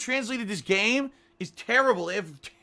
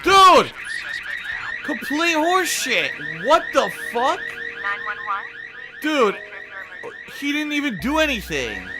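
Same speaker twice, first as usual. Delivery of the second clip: outraged yell; 6 semitones higher than usual. Real, not acted. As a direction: yell at the unfair game. Outraged at game battle.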